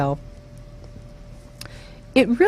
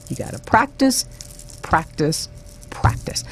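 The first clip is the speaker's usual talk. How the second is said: fading to a whisper; 5 dB quieter than usual. Shown as a demonstration. Repeating the word, fading away.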